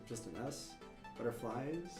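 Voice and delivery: normal talking voice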